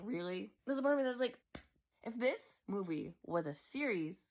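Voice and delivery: normal talking voice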